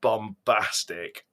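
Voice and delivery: ordinary talk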